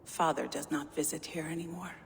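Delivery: usual speaking voice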